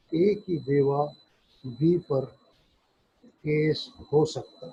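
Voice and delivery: ordinary talk, nothing marked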